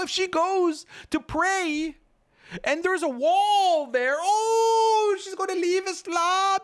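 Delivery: hammed-up fake sobbing in a very high falsetto; mock crying. Mocking reformists' tearful plea over sisters leaving Islam, mock sobbing. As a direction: wail it with fake tears. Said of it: "the tears start coming down".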